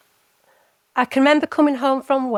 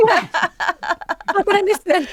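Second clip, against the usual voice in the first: laughing; 9 semitones higher than usual. Real, not acted. Joking about ignoring husband.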